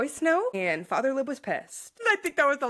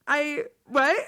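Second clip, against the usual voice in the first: confused quote; 6 semitones higher, 3 dB louder than usual. Retold or imitated. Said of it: "she'll be like".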